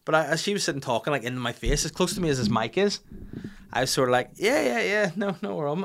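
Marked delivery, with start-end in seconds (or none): 4.3-5.8 high, placating agreement; mock-polite, awkward brush-off; trying to be subtle. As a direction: humour her politely, pitched up. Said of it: "just trying to, the other way, be subtle".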